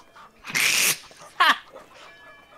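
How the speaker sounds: high, loud burst of laughter. Real, not acted. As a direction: howl with laughter at the joke. Reacting to awful joke.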